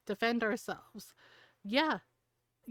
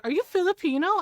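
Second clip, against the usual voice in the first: higher, louder surprised question; retold excited surprise; 7 semitones higher, 6 dB louder than usual. Stranger on the train discovering a fellow Filipino, excited. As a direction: imitate an eager stranger asking, voice jumping up.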